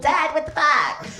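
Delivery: put-on high, squeaky little-kid voice; child's high-pitched protest; eight-year-old self. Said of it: "Me, me at eight years old".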